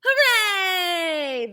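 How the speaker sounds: high, loud joyful cheer; cheering hooray. A narrator's reading. Story bird cheering after the rescue, loud and joyful. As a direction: shout it out in triumph.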